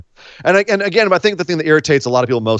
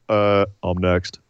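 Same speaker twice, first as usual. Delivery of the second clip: mock Roman mumble; 8 semitones lower, 5 dB quieter, slower than usual. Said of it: "walk out and be like".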